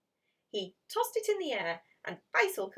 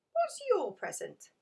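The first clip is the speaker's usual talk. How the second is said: put-on eager little boy; 13 semitones higher, 5 dB louder than usual. Child asks about the present.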